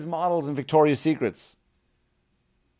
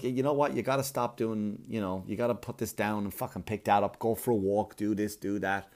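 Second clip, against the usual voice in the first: mock doctor; 6 semitones lower, 7 dB quieter than usual. Doctor's advice acted.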